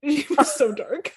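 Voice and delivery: laughing through the words. Real, not acted. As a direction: say it while laughing. Laughing at dark cartoon.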